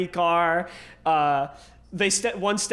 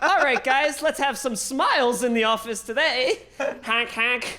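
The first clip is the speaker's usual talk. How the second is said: silly clown; 5 semitones higher than usual. Retold or imitated. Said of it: "he's like".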